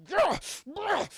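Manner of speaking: high, loud mock growls; imitated grunting shoves. Cop throwing kids around the arcade, high forceful grunts. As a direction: grunt as if hurling people out of the way.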